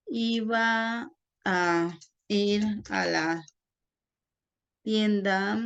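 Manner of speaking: slow, halting word by word; slow dictation. Shown as a demonstration. Teacher making up an example sentence, slow dictation. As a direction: compose it slowly as you write. Composing an example while writing.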